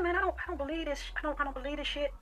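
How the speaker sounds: a breathless can't-believe-it under the breath; hushed disbelief. Retold or imitated. Stunned by host's advances.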